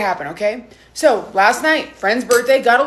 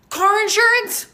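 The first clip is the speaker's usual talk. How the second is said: high, disbelieving question; incredulous; 9 semitones higher, slower than usual. Real, not acted. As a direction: scoff at the very idea. Incredulous at fake ad.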